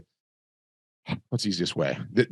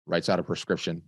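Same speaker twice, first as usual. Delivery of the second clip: ordinary talk, nothing marked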